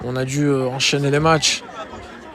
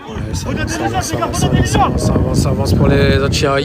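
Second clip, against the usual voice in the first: live play-by-play. Acted.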